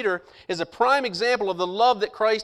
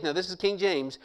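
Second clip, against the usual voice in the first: ordinary delivery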